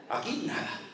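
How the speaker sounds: Aquí nada repeated as a laughing whisper. Retold or imitated. Pastor answers a sister at the empty tomb, in a laughing whisper. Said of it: "Le digo".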